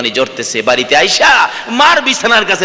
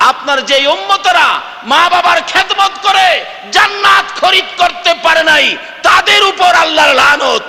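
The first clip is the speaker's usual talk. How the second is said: preaching at full force; 14 semitones higher than usual. Acted. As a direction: climb and shout the curse. Repeated phrase rises to full cry.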